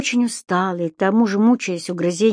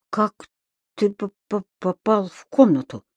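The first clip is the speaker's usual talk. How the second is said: stuttering. A narrator's reading. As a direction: stammer in astonishment. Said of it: "заикаясь от изумления". (заикаясь от изумления)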